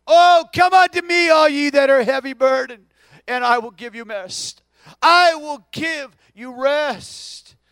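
loud, ringing scripture cry; full-force proclamation of the invitation; preaching climax. Real, not acted. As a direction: lift it and proclaim. Preacher crescendo quoting scripture.

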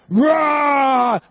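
long, loud mock growl; playful bear roar. Dad chasing kids around the house, roaring. Father plays the bear again.

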